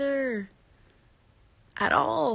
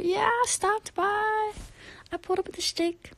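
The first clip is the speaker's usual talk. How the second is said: playful squeak; 11 semitones higher than usual. Pretend. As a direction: pipe it up like a cartoon.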